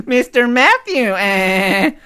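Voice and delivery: high, slow nanny drawl through a laugh; giggling Fran Drescher put-on; Fran Drescher impression. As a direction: aim the nanny voice at your co-host. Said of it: "impersonating Fran Drescher".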